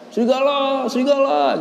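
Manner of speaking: high calling shout of serigala; crying wolf. Retold or imitated. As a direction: call out in mock alarm to the village. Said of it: "dia teriak-teriak". (teriakan minta tolong yang tinggi)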